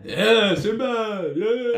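loud, high whoop of support; mock cheering. Co-host cheers Sinbad as top choice, loud high cheering. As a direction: cheer it out like a fan.

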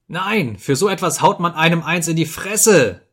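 high-pitched indignant screech. A narrator's reading. Ferfitschkin outraged at the insulting toast, screeching. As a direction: shriek it in outrage, pitch right up. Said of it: "kreischte Ferfitschkin".

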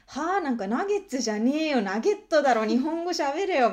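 loud, high jeering schoolkid; 馬鹿にする子供の声; mocking kid. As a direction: sneer it like a bratty classmate. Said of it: "すごい言われて".

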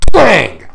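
high, loud twang falling in pitch; sudden loud "twang" sound effect; imitated twang. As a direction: fire it off like a snapped cord. Imitates a cord firing off.

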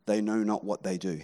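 almost breathe the words; Jesus forgiving from the cross, very low and soft; hushed Jesus on the cross. Acted. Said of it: "Jesus on a cross says".

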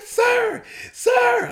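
very high, loud hail; imitated yelling. Dog owners hail the man in the street, imitated high shout. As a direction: holler to get a stranger's attention. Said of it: "I heard someone yelling".

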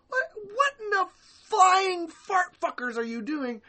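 very high, loud, slow squealing outrage; mock outraged shriek. Fans reacting to bans, cartoonish shrieking outrage. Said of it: "We're just like".

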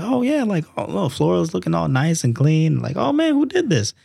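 impressed mom. Retold or imitated. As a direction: play a delighted mom finding the clean floor. Mom voiced in a story.

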